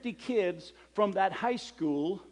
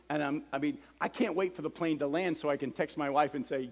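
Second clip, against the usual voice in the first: ordinary talk, nothing marked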